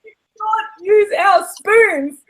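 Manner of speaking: high, slow, drawn-out outrage; indignant protest. Retold or imitated. Acting out outraged reaction.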